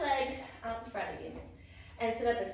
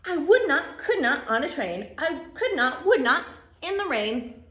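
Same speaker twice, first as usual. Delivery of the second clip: loud, punchy, falling phrases; emphatic storybook refusal; 3 semitones higher, 10 dB louder than usual. Acted. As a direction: refuse firmly, hammering every phrase. Reads the refusing character's part.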